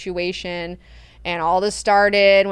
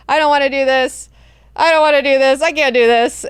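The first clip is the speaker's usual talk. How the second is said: panicked outburst; 5 semitones higher, 8 dB louder than usual. Retold or imitated. Paul's overwhelmed outburst, retold.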